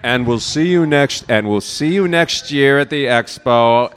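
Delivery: loud send-off to the crowd; calling out. Real, not acted. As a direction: project a big farewell to the whole room.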